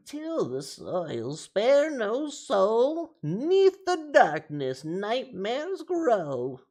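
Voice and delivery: put-on high, very slow, loud old crone; old lady voice. Streamer redoing the rhyme on a viewer's request, old lady voice. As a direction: stretch every word out as a hammed-up old lady. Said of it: "say it in an old lady voice".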